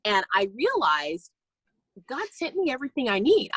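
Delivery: ordinary talk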